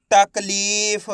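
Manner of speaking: तकलीफ with a long-held lee; blended word demo. Shown as a demonstration. Teacher reading for pupils to repeat, तकलीफ stretched. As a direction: draw out तकलीफ slowly by syllable. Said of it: "आपको मेरे साथ साथ repeat करना है".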